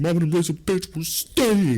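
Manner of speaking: high, loud-ish strained falsetto; hammed-up squeaky bravado; panicked defiance. Repeats panicked refusal.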